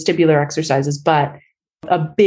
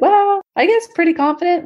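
much higher, hedging and modest, with a rising end; Canadian understatement; 13 semitones higher than usual. Retold or imitated. Asked by the doctor how confident she is, answering with high-pitched Canadian understatement. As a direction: play it down like a polite Canadian, voice lifted. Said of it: "very Canadian in my response".

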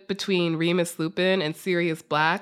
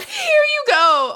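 very high, slow, crying wail; wailing sarcastic echo; 7 dB louder, slower than usual. Retold or imitated. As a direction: wail it like a sob. Echoing friend's sarcastic line.

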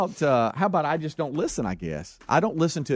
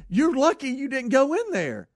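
high-pitched imitation of the worked-up owner; retold scolding; 10 semitones higher than usual. Owner tells driver of near miss, mock scolding. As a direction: mimic his warning, voice pitched up.

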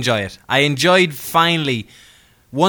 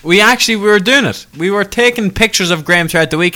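higher and louder, eager to tell; animated interjection; 7 semitones higher, 6 dB louder than usual. Real, not acted. Co-host cutting in about photographing Graham, animated interjection. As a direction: jump in loudly with the news. Excited, talking over to agree.

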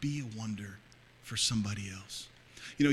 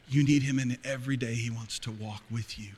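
normal talking voice

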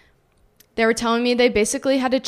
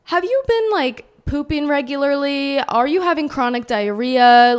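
much higher prim doctor voice; imitating the doctor; 5 semitones higher, 4 dB louder than usual. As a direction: ask it in a sing-song clinical lilt. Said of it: "They're like".

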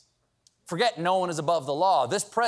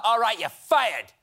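police chief. Retold or imitated. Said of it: "That's like saying to a bad cop".

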